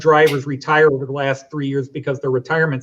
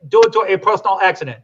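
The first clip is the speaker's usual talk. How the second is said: put-on German accented announcement, pitched higher; 6 semitones higher, 3 dB louder than usual. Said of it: "I don't know why I do a German accent".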